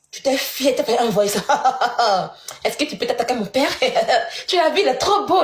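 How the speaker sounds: parler en riant, avec moquerie; rire moqueur. Real, not acted. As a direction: se moquer en éclatant de rire entre les phrases. (rire moqueur, railleur)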